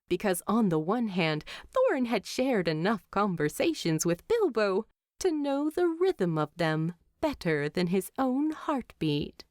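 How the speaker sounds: usual speaking voice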